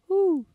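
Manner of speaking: high owl hoot. Retold or imitated. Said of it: "all you hear is".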